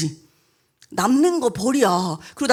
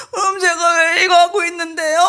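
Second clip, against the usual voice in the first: put-on sobbing child asking why, pitch up; a wronged child crying out to her teacher; crying protest; 6 semitones higher than usual. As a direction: push the question out through tears. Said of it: "우리 막 울면서".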